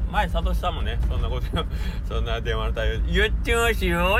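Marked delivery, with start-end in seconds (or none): 3.1-4.2 through gritted teeth. Retold or imitated. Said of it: "歯を噛みながら".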